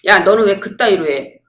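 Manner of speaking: loud, mildly angry demand; acted scolding. Someone attacking the listener over their behaviour, acted scolding. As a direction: lash out in a lightly acted rebuke. Acts someone lashing out in anger.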